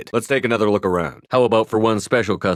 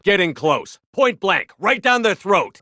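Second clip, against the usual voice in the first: much higher, rising battle excitement; fired-up urging; 12 semitones higher, slower than usual. Acted. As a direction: pump up the squad for the fight. Urging close-range combat.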